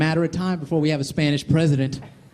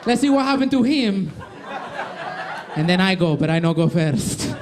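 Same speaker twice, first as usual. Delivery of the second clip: high, slow mock Spanish-accented candidate; 3 semitones higher, slower than usual. Spanish candidate Ramirez refusing to run first, in a Spanish accent. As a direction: ham up a nervous accented Ramirez. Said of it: "He's like".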